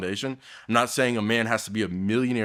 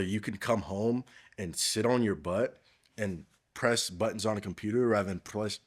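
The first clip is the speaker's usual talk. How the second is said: usual speaking voice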